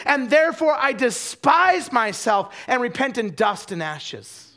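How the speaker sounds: high, loud, drawn-out proclamation; impassioned preacher delivering Job's reply; full-force preaching. Acted. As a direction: cry out the scripture slowly at full volume.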